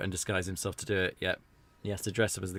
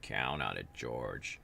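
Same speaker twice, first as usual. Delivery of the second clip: low American accent, mimicking Dwayne; American accent imitation; 10 semitones lower, 7 dB quieter, much slower than usual. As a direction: echo the character in a lower American voice. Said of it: "us Brits have a quite a good handle on the American accent".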